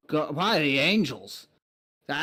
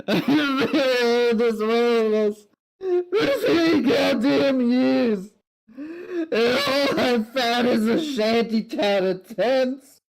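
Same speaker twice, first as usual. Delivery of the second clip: slow, high, loud wailing lament; sobbing lament; 8 semitones higher, 5 dB louder, slower than usual. Acted. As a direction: wail it out, drawn-out and broken. Crying knight laments lost years.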